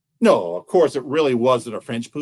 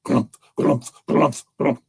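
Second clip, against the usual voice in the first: rhythmic heavy thumping noises; imitated lumbering gallop; bear footfalls; 3 semitones lower, slower than usual. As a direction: thud out evenly spaced bear steps. Imitating bear's heavy running feet.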